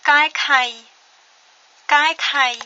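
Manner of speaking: teacher models Kaikai for repetition; Khmer word model. Shown as a demonstration. Teacher to learners, modelling Kaikai clearly. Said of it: "Et le dernier mot".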